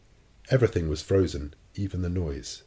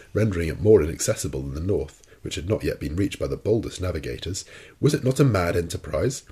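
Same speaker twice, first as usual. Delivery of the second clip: usual speaking voice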